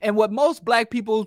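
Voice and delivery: ordinary talk, nothing marked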